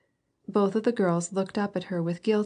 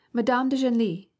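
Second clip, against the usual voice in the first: merry teasing shout, higher and louder, "Madame de Genlis!" called out; 5 semitones higher, 4 dB louder than usual. A narrator's reading. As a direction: tease her by shouting "Madame de Genlis!" from the next room. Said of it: "shouted the merry voices".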